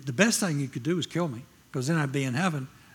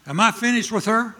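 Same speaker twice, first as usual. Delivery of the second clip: higher, louder sharp rebuke; little slapping; 7 semitones higher, 8 dB louder than usual. Retold or imitated. God sharply confronting the preacher, like a little slap. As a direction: snap the question like a slap. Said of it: "Like a s-, little slapping".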